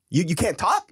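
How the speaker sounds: high, loud incredulous challenge; annoyed confrontation. Retold or imitated. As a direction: re-enact challenging a tongue-tied kid, loudly. Re-enacts confronting the quiet boyfriend.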